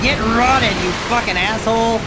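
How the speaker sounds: loud, high-pitched cursing at an enemy; real heated yell at a game foe; angry gamer shout. Real, not acted. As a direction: shout the insult mid-fight.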